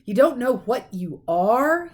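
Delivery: slow, rising disbelief; stunned disbelief. A narrator's reading. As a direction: say it twice, stunned. Giant in disbelief.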